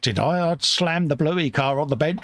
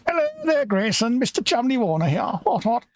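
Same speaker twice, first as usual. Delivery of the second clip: put-on posh old English gent; 10 semitones higher than usual. Posh gent introduces himself.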